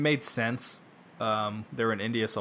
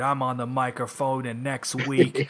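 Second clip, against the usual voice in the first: wrestler promo voice. Retold or imitated. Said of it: "there's a way that wrestlers talk".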